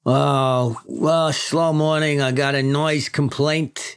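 slow, reluctant New York boss; lightly voiced precinct chief handing out work; police chief. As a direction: break dull news to your detectives.

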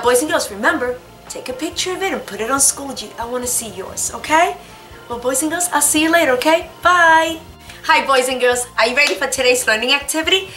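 ordinary talk

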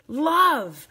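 thrilled, emphatic exclamation; gushing delight. Real, not acted. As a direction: belt it out with real delight. Gushing over the finished embossing.

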